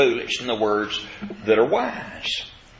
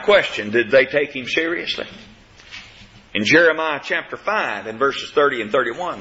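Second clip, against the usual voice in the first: usual speaking voice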